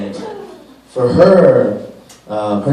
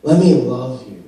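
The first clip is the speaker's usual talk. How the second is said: ordinary delivery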